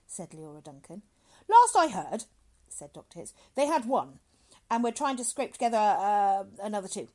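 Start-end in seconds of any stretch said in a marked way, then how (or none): 1.5-7.1 hearty doctor. Acted. Doctor updates woman on volunteers, loudly hearty. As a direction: boom it out briskly. Doctor answers her question.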